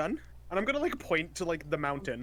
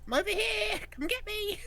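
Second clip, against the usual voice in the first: lightly voiced, higher taunting call; a hint of a taunting lure; luring the goons away with a fake voice; Lepori's voice. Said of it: "I'm gonna make Lepori go".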